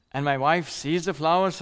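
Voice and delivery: normal talking voice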